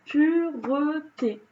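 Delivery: slow "pureté" in three beats, py-ʀə-te; three syllables. Shown as a demonstration. Teacher counts the syllables of "pureté" for class. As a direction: sound out every syllable of "pureté". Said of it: "il y en a bien trois".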